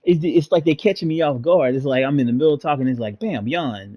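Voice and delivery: loud, fast laughing rant; blurting it out, half laughing at herself; excited rant. Real, not acted. Reader rants about sudden yawns.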